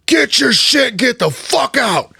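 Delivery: high, loud furious yell; angry chief. Retold or imitated. Chief woken at night yelling about the microphone, furious. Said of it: "And the Chief's like".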